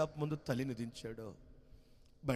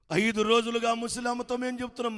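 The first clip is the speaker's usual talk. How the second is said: reproachful villager; 9 semitones higher, 8 dB louder than usual. A narrator's reading.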